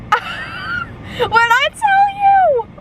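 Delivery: high slow laughing cry; laughing shriek. Real, not acted. Fan reacting to a twist, laughing high shriek. Losing it over the book.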